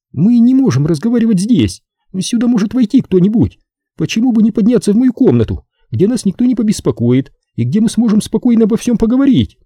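very high-pitched female character voice; high, persuasive woman; coaxing Zelda. Acted. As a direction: coax him in a high woman's voice.

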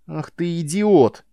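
loud, slow, angry scolding; angry outburst. A narrator's reading. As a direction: drag out the insult in disgust. Soldier insults comrade.

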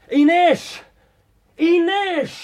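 calling her name from afar. Acted. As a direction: call after her across the square. Calling after her in street. (laut hinterherrufen)

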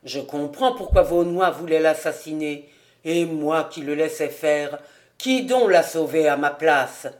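low, musing father's voice; talking to himself. A narrator's reading. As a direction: reason it out aloud in a deep voice. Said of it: "se parlant toujours à lui-même". (réflexion grave, comme à soi-même)

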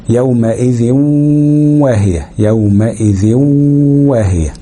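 slow يومئذ واهية with a long nasal w joining them; "yawma'idhiw-waahiya", w stretched and hummed; waw ghunna. Shown as a demonstration. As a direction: hold the merge before واهية. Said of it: "بغنة".

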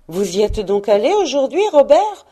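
rising to a high, loud finish; surprised question. A narrator's reading. Wife asking husband whether he went today, surprised. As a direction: ask in surprise, pitch leaping on the name.

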